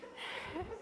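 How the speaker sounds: breathy amused chuckle; a quick laugh at the story; soft laugh. Real, not acted.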